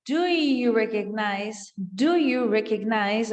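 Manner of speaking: slow, sounds joined together; linked sounds. Shown as a demonstration. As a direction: glide the words into each other. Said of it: "Ele junta os sons". (sons juntos, devagar)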